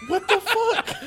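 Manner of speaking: laughing in disbelief. Real, not acted. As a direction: blurt it out through laughter. Laughing at chalk drawing.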